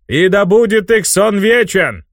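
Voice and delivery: high, slow, solemn vow; solemn chorus. Acted. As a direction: intone it as a ritual oath. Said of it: "Хором ответили четыре новых жреца".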